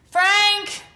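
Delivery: loud call across the house. Acted. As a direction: yell for your husband to come. Mom calling husband for help.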